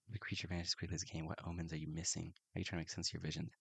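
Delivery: soft, quick reading of the card text; quiet game-text reading; hushed prompt reading. Acted.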